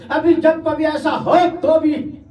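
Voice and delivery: high, slow, forceful cry; impassioned pulpit exclamation; preaching outburst. Retold or imitated. Preacher bursts into full force.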